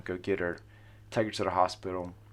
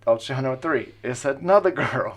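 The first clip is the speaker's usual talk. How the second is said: excited disbelief; 5 semitones higher, 8 dB louder than usual. Real, not acted. Third girl reveal, disbelief.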